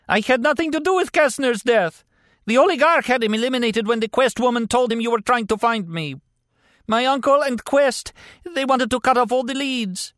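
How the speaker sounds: very high, strained denial; pained, desperate captive. A narrator's reading. Injured captive denying the killing to his interrogator, desperate. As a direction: plead it high, through agony.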